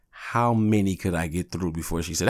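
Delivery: usual speaking voice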